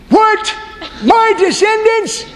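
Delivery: high squeaky disbelief; stunned Abraham. Acted. Abraham reacts to God's promise in high comic disbelief. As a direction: squeak it out in shock.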